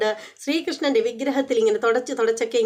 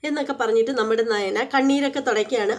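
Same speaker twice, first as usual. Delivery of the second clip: ordinary delivery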